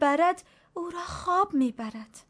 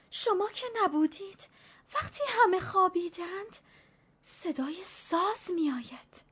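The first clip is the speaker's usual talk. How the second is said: put-on high, hushed old woman's frightened tale; a hint of fear, higher and dropping to a hush; terrified; 8 semitones higher than usual. A narrator's reading. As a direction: tell it as if the ghost might hear. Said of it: "با حالت وحشت زده گفت".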